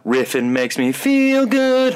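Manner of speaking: high, slow goofy clown voice; sing-song it like a dopey clown; silly clown. Acted. Silly intro voice.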